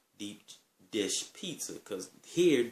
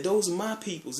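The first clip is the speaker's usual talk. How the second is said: acted protest; 10 semitones higher, 4 dB louder than usual. Friend defends them, retold.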